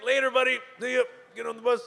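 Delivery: lightly voiced cheery driver; breezy bus driver. Bus driver waves the jiggling rider through, breezy.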